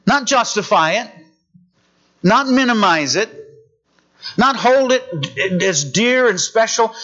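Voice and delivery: ordinary talk, nothing marked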